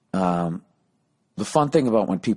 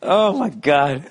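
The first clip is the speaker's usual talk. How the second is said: laughing; 7 semitones higher, 4 dB louder, much slower than usual. Real, not acted. Recovering from laughter.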